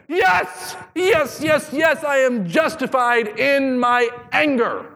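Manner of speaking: very high, slow petulant insisting; high mock whine, drawn out; whiny Jonah. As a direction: whine it like a sulking child. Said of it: "What's he say?".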